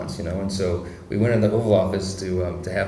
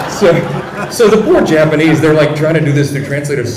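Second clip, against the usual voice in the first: laughing while talking; 6 semitones higher, 10 dB louder than usual. Real, not acted.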